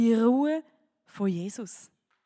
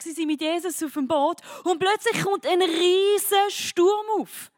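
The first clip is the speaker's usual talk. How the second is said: louder, higher, building storm retelling; preaching at full force; 7 semitones higher, 6 dB louder, slower than usual. Acted. Preacher retelling the storm to the youth, full-force build to a slow punch line. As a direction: paint the storm, bigger and bigger.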